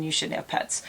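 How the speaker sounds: hushed, pointed aside to the audience; whispered aside. Real, not acted. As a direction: drop to a whisper for the jab. Judging aside to viewers.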